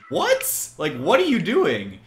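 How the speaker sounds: high, loud mock-shocked "What?"; retold incredulous outburst. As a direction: act out baffled disbelief, pitch shooting up. Retold baffled reaction to opponent.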